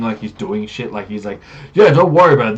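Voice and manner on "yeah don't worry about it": loud, higher, swaggering brag; boastful paladin. Acted. Paladin bragging about his kills to the party, loud and pompous. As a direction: oversell every feat like a showman hero. Paladin hacking at bushes, brash.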